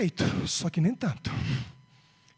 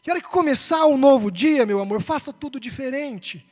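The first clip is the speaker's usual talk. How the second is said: raised, loud fatherly exhortation; fervent urging; 5 semitones higher, 7 dB louder than usual. Retold or imitated. Father encouraging his son to try again tomorrow, fervent. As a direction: urge him on with mounting force and higher pitch.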